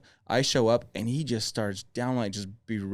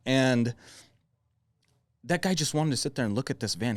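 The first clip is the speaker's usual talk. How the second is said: usual speaking voice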